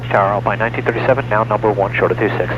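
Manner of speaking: low, fast radio call; pilot over the radio. Real, not acted. Other pilot heard on radio.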